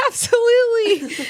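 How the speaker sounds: high, loud laugh through the word; laughing agreement. Real, not acted. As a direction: burst out laughing as you agree.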